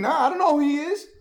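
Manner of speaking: high-pitched, mock dismissive denial; acted denial. Peter's acted denial in story.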